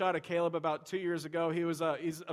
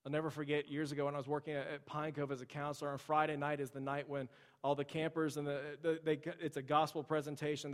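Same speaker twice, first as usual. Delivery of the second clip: ordinary talk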